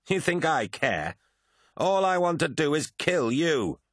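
high, scornful battle jeer; defiant taunt. Acted. Lucius laughs in Prahl's face, high defiant taunt. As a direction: throw it at your enemy, pitched right up. Taunting an enemy mid-fight.

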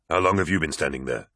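plain reading voice

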